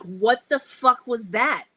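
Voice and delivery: loud, slowed startled curse; startled outburst. Retold or imitated. Camper woken by a bang, startled loud curse. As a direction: blurt it out, jolted awake by a bang.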